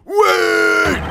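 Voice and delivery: high, acted cry to halt; desperate shout. Defender stopping the court mid-trial, desperate shout. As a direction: cry out high, stretching the word.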